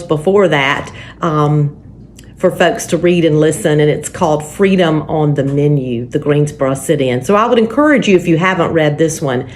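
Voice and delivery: usual speaking voice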